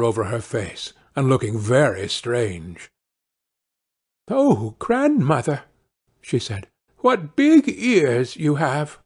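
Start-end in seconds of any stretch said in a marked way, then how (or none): 4.3-5.6 high, slow astonishment; drawn-out high wonder; wondering girl. Acted. Girl marvels at disguised wolf. 7.0-9.0 slow, raised amazement; puzzled little girl, drawn out; wondering girl. Acted. Girl marvels at disguised wolf.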